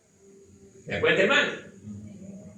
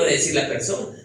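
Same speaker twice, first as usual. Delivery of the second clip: usual speaking voice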